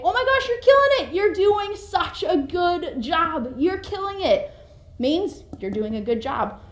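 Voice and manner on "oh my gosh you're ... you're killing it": high, bright cheering praise, quick at first; enthusiastic praise. Shown as a demonstration. Cheering on someone doing well, gushing excitedly. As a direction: gush like a proud cheerleader. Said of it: "You can say".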